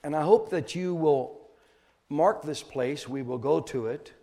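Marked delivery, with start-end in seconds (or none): none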